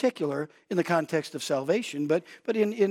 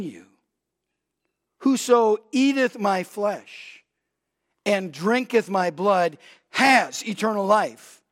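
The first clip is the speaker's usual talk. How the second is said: solemn preaching; 6 semitones higher, 6 dB louder than usual. Acted. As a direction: weigh every word of the verse. Climactic scripture reading.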